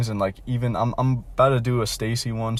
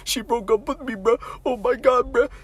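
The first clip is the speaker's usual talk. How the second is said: much higher whimpering wail, imitated; mock crying; 13 semitones higher than usual. Heartbroken friend crying to the lunch table, mock wail. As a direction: play the heartbroken friend sobbing, pitched way up. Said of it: "he's crying so bad".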